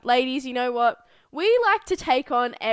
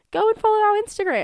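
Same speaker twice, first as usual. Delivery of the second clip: quick, higher-pitched push; mock-bossy order; 8 semitones higher, 3 dB louder, faster than usual. Host pushing listeners to follow on Instagram, mock-bossy. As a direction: order them about playfully.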